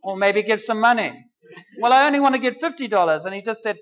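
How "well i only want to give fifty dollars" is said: high, fast haggling; a quick, defensive bargain; mock bargaining. Believer bargaining with God.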